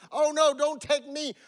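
high, loud storybook plea; lightly voiced goat begging the troll; a hint of alarm, pitched high; pleading goat. A narrator's reading.

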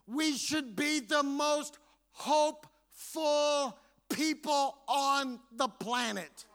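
drawn out, each word weighted; slow it right down; slow emphasis. Real, not acted. Preacher urging the church, slow and weighty.

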